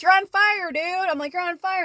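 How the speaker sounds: loud, high alarmed yelling; panicked yell. Retold or imitated. Yelling to her cat about its burning fur, panicked. Cat's fur caught fire.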